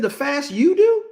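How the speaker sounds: high, incredulous scoff with laughter; mocking God's voice. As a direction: laugh at the very idea, voicing God. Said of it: "That's why he said".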